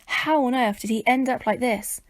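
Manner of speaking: ordinary delivery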